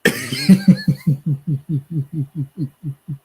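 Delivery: long, loud run of giggles; helpless high laughter that keeps bubbling on; laughing. Real, not acted. Host can't get over viewer's comment, laughing.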